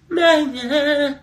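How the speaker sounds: high loud shouts of yeah; cheering. Real, not acted. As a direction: whoop with joy.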